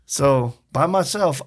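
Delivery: usual speaking voice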